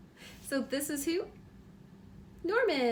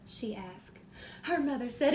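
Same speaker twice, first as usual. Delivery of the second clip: bright, lively storybook reading; reading to children; 2 semitones higher, 7 dB louder than usual. A narrator's reading. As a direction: read it like bedtime story time for a little one. Reads picture book aloud.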